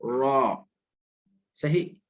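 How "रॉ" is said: रॉ said thick and heavy with rounded aw; रॉ, held with a dark vowel; thick letter. Shown as a demonstration. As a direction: give रॉ a full, heavy Arabic r. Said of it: "थोड़ा मोटा भी अदा करना है".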